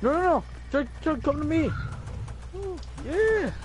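excited calling. Real, not acted. Calling pet Chug over excitedly.

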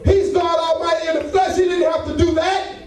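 rising preacher's cadence, calling out; full-force preaching. Acted. Preacher calling out to the congregation, fervent.

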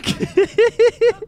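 loud high burst of laughter; laughing. Real, not acted. Laughs at teammate's biscuit joke. (ज़ोर की ऊँची हँसी)

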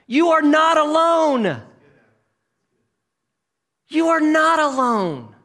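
high, loud, drawn-out preaching; emphatic proclamation. Acted. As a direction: proclaim it slowly with rising force. Preacher voicing God's firm rebuke.

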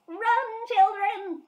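high, loud, mock-panicked alarm; playful fake alarm call to listeners; shouting run. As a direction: yell a warning to the kids. Mock scary shout at children.

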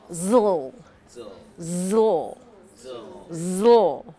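zl drawn out, tongue behind the teeth; zl sound demo. Shown as a demonstration. Teacher modelling zl for students. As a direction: model the zl slowly for the class. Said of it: "Like you would say".

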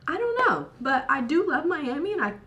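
cracked, lost voice; voice cracking. Real, not acted. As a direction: voice breaking high. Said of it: "I lost my voice".

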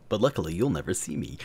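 fast unvoiced whisper; whispered aside. Joking aside to viewers, whispered. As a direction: hush it like a secret. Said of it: "aside whisper".

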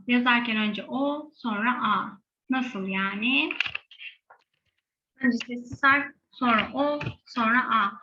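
normal talking voice

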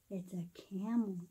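usual speaking voice

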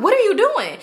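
high-pitched appalled question; acted shock. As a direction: replay the disbelief, pitch leaping up. Retold shock at friend peeing.

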